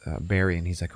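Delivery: ordinary talk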